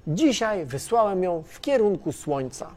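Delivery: ordinary talk, nothing marked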